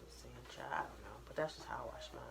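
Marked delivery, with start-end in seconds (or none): 1.3-2.2 whispered aside; whispering. Real, not acted. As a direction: whisper it to the camera. Private aside to viewers.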